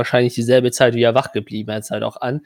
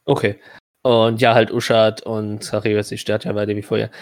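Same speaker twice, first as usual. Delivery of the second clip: ordinary delivery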